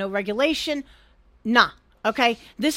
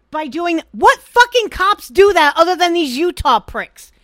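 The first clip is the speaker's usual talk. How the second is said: loud, raised, outraged burst; indignant rant; 4 semitones higher, 8 dB louder than usual. Real, not acted. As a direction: rail at them in disbelief. Angry rant about police.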